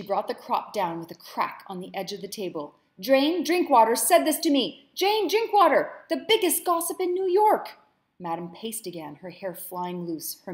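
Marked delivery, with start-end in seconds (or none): 3.0-4.9 high, loud enraged outburst; furious shout. Acted. Madam shouts in rage. 4.9-6.0 much higher, slow, loud emphasis on Drinkwater; shrieking repeat. Acted. Madam repeats name in rage. 6.1-7.8 high, loud scornful shout; shrill fury. Acted. Madam rages.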